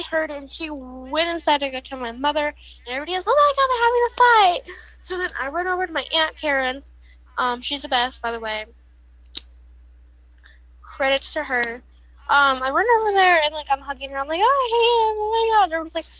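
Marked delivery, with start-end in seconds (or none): 3.3-4.8 excited gossip. Retold or imitated. Everybody reacting to fight. 14.4-15.7 crying. Retold or imitated. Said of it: "I'm crying".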